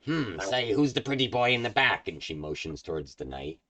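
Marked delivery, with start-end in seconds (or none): 0.0-2.1 flirty hag. Acted.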